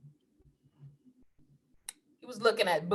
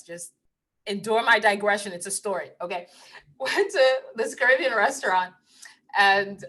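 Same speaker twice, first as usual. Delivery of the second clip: ordinary talk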